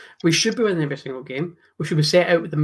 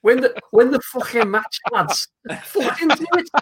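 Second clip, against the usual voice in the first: loud, higher-pitched team-talk shout in a bad accent; badly faked footballer's accent, rallying the lads; Steven Gerrard; 7 semitones higher, 4 dB louder than usual. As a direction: belt out a terrible accent impression. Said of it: "That was a terrible accent".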